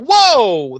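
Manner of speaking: high, loud drawn-out whoa; mock astonishment. Host reacting to Riley jumping to a conclusion, astonished. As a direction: blurt it out in exaggerated surprise. Host's retold shock at leap.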